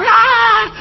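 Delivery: high-pitched mock scream; imitated crowd scream. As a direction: mimic people screaming, without full volume. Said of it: "así que la gente gritaba". (grito agudo imitando a la gente)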